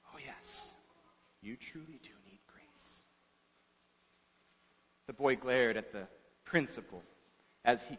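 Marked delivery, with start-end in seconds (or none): 0.1-3.0 hushed, knowing whisper. A narrator's reading. Principal to the unruly boy after studying his face, whispering. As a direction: whisper it very softly and low. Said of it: "he whispers".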